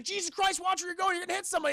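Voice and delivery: high-pitched mock panic; acted panicky warning; panicking. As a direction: fret at a reckless driver, pitch way up. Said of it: "[name]'s just kinda panicking".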